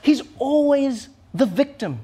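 ordinary delivery

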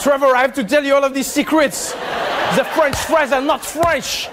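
mock-dramatic French accent, stretching out the final line; passionate French accent. Comedian imitating a Frenchman revealing secrets, passionate French accent. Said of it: "you said that with passion".

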